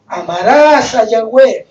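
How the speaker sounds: loud, drawn-out scriptural command; preached commandment. Acted. Preacher quotes the commandment to love God, preached commandment. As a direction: proclaim it slowly and forcefully.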